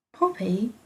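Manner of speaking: usual speaking voice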